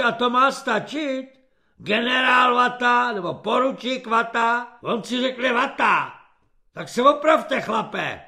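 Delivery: high, loud indignant scolding; scornful dressing-down. A narrator's reading. Corporal scolding recruit for improper report, loud and scornful.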